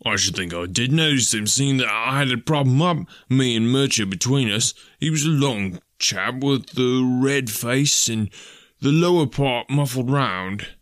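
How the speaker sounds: indignant rustic policeman; put-out police constable. Acted. Constable insists he noticed the drunk's looks, emphatic and put-out.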